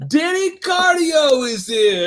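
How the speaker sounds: high, drawn-out mock holler; loud, stretched comic cry; hammed-up shout. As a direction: belt it out like a rowdy announcement.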